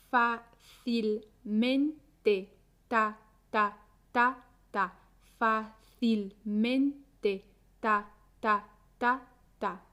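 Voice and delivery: very slow "Fácilmente", fa... cil... men... te, then "ta" beats; syllable by syllable. Shown as a demonstration. Teacher counting four syllables for learners, "Fácilmente" slowly broken apart. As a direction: separate every syllable of "Fácilmente" with a pause, echoed as ta, ta, ta, ta. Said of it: "Fácilmente tiene cuatro sílabas".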